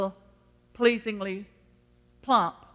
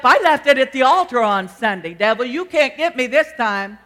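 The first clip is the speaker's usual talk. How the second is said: loud, raised defiance at the devil; defiant declaration; 4 semitones higher, 8 dB louder than usual. Acted. Acting out believer defying the devil.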